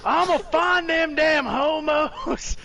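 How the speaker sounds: high, slow, hammed-up bigot voice; mock homophobe voice. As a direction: drawl it out like a dumb bigot. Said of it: "missed the point of this one".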